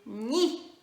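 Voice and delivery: loud, clear "Ni"; teacher repeating "Ni"; syllable drill. Shown as a demonstration. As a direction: repeat "Ni" firmly for the class. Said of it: "C'est pas très agréable à faire".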